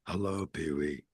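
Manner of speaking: deep rumbling puppet flower voice; low Flory voice. Retold or imitated. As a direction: growl it low like a creature under the bed. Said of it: "he's got that low voice".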